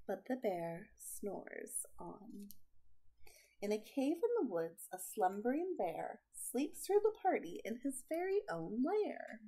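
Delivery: put-on slow, lilting read-aloud for children; storybook reading. A narrator's reading. Aunt reading a picture book to kids, gentle and lilting. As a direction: read it like bedtime for little ones.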